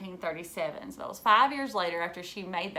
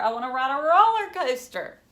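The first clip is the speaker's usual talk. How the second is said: eager; 5 semitones higher, 7 dB louder than usual. Acted. Child eager for the fair.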